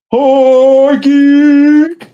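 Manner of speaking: loud, high hype call of the name; a loud, high shout-out. Real, not acted. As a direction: holler the name like a hype man.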